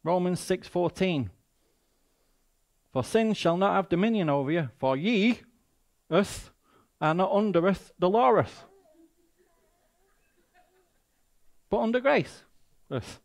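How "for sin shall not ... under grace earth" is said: mock King James reading. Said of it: "King Jimmy".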